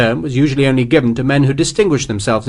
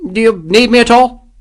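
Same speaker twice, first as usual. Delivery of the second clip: higher, louder eager officer; anxious major; 11 semitones higher, 5 dB louder than usual. A narrator's reading. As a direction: ask hoping to be useful. Major voiced in story.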